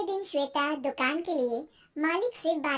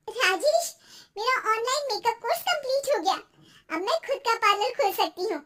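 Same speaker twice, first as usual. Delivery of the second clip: higher, eager wife's voice; delighted housewife, pitched up; excited wife; 6 semitones higher than usual. Acted. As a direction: burst out with your good news.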